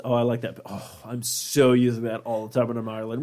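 usual speaking voice

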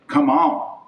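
ordinary delivery

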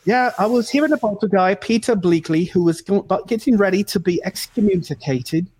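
a hint of the boss's English accent; boss impression. To make the English guest feel at home, a light English accent. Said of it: "my boss impression".

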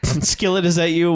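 high, questioning retold call; mock calling out. Photographer hears something in the other room, mock calling out. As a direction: call lightly into the next room.

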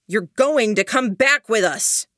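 calling out. Acted. Duncan orders Sylvester back, loud stern call. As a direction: order him back across the smoke. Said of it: "Duncan called out".

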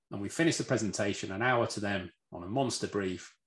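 usual speaking voice

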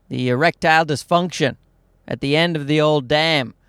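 ordinary delivery

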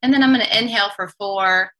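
slow breath counting. Acted. Leads a guided breathing exercise.

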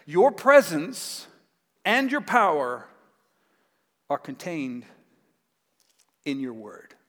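slow and deliberate, stressing "presence" and "power"; a preacher driving the point home slowly; slow, weighty emphasis. Real, not acted. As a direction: drag each phrase out for weight. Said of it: "what the centurion is saying is this".

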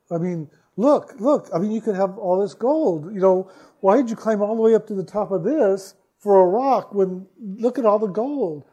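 high, loud, baffled objection; incredulous protest. Retold or imitated. Dreamer questions climber who ignores the gold, incredulous. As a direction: protest in disbelief, pitch climbing. Arguing with man over gold.

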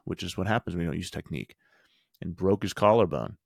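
ordinary delivery

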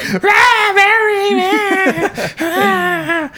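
very high, loud hammed-up wail of Barry's name; mock wailing. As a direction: shriek uselessly instead of warning. Mocking the character's useless wailing.

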